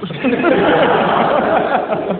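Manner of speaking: loud high laugh; laughing at himself. Real, not acted. Comic laughing at his own knitting thought. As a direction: burst out laughing at the knitting idea.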